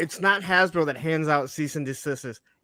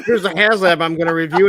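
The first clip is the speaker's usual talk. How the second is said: loud imitation of a toy reviewer; mock reviewer announcement; 9 dB louder than usual. Host mimics the guy showing off a HasLab, loud mock announcement.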